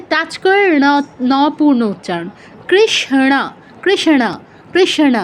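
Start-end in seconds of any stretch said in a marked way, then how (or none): 2.7-5.2 কৃষ্ণ as krish-na, curled-tongue sh and full retroflex na; touch sh, then flap the na in কৃষ্ণ; retroflex Sanskrit demo. Shown as a demonstration. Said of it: "এরকম". (কৃষ্ণ, মূর্ধন্য ষ ও ণ দিয়ে)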